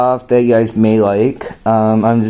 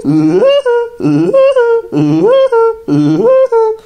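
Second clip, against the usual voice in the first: very high staccato cartoon cackle; louder high rat-a-tat bird laugh; rat-a-tat woodpecker; 4 dB louder than usual. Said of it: "Woody Woodpecker's gonna say something".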